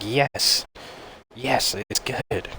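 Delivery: insist, worn down by being asked; exasperated yes. Retold or imitated. Patient answers the nurse's repeated question, exasperated. Asked yet again by staff.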